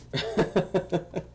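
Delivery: high run of quick chuckles; amused chuckle. Real, not acted. Gamer realizes he's using a crappy lightsaber, amused chuckling. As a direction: laugh at yourself.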